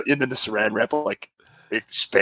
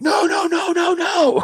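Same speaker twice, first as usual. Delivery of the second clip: much higher, urgent repeated no; alarmed shout; slower than usual. Retold or imitated. To daughter putting foil in microwave, alarmed shout. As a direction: yell to stop her right now.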